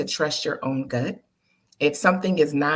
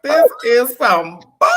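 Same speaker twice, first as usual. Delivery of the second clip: slow, higher, louder mock complaint; mock outrage; 7 semitones higher, 9 dB louder, slower than usual. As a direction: ham up the frustration, drawn out. Venting frustration in the car.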